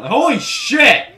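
high, loud, drawn-out startled cry; real surprised shout; shocked yell. Real, not acted. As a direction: caught off guard by the fall. Sudden drop in game.

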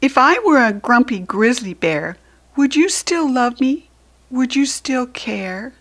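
put-on high, loud pleading child voice; small child asking. Small asking Large about love, a high pleading child voice. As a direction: ask anxiously like a little one.

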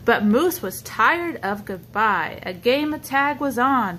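ordinary delivery

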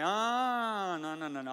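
high drawn-out "ah", then quick refusals; mock protest. Young man resisting God's call, protesting.

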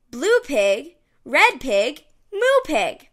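ordinary delivery, nothing marked

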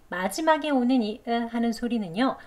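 usual speaking voice

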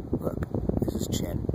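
hushed whisper. Real, not acted. Vlogger whispers as he points out the chin. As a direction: breathe it out, voice gone.

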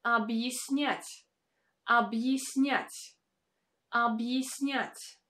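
объяснять slow, я said as y-e, unstressed. Shown as a demonstration. Teacher slowly modelling объяснять, я as y-e. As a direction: slowly say объяснять as ab-yis-nyat. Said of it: "stands in the unstressed position".

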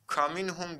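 "Kaminhum" starting with KA instead of FE; wrong reading. Shown as a demonstration. Teacher quizzes students with a wrong reading. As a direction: swap the opening to KA in "Kaminhum". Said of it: "diye okuduk mu?".

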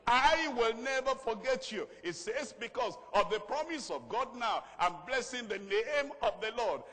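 loud, high preacher's proclamation; full-force sermon delivery; rousing preaching. Real, not acted. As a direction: proclaim the promise to a congregation, drawing words out.

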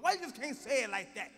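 ordinary talk, nothing marked

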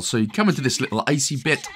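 usual speaking voice

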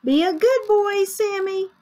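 high, singsong mom voice; mother's send-off. Acted. Mother sends Sammy off to school, calling brightly. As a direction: call a fond reminder after a child.

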